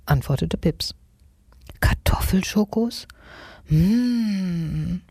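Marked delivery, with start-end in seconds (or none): 1.8-5.0 raised query into a long, savouring hum; delighted cry. A narrator's reading. Nagezahn hears of potato chocolate, delighted savouring. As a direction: relish the idea, ending in a drawn-out mm. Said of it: "schrie Nagezahn und warf voll Freude".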